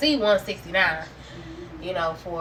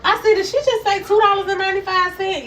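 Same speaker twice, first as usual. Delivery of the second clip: high, loud disbelieving question; shocked disbelief; 11 semitones higher, 6 dB louder than usual. Retold or imitated. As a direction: ask it in stunned disbelief. Shocked at price.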